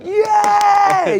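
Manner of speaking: high, drawn-out cheer; mock crowd cheer. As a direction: cheer like a delighted audience.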